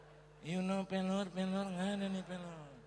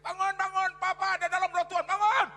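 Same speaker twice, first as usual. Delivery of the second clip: shouted wake-up call, high and fast; wife waking the children with a loud urgent shout; 10 semitones higher, 13 dB louder than usual. Retold or imitated. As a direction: yell at the kids to wake. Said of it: "istri saya bangun lari".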